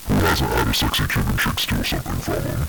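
very low, crackly caller; weary client down a noisy line; sighing phone voice. Acted. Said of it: "the voice sighs and is like".

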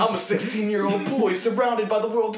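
high, slow, grand anime protagonist voice; earnest anime hero. Pretend. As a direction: announce it like a shonen hero. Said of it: "you sound like an anime protagonist".

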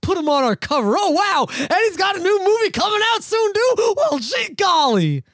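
high, chipper magazine editor; G Q exec voice. Retold or imitated. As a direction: act out a gushing editor. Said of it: "just because G Q thinks".

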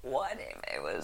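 low croak on an inhaled breath; dying frog voice. Acted. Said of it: "you're a dying frog".

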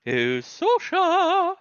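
very high, slow, loud greeting; delighted call. Acted. Calling out to a friend.

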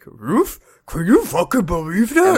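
high, put-on talking dog voice; a disbelieving dog yelping out words; Moose. Family dog Moose reacting to the scene, incredulous talking-dog voice. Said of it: "Moose is like".

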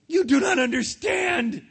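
high mock scream. Phil to SpongeBob, hammed-up high scream. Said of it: "screamed Phil".

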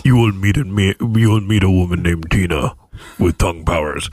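low, solemn prophecy voice; God voice. Pretend. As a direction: foretell it grandly.

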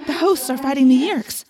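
echoing, astonished report; urgent report. A narrator's reading. Narrator telling Jake the hosts are rebelling, urgent.

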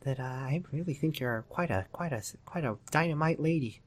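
whisper-soft sleazy charm; hushed mock-seductive college guy; creepy Wes voice.

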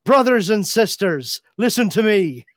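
hammed-up Irish preacher voice; mock Irish accent. Mocking Fassbender's Magneto in an Irish accent. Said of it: "Irish accent".

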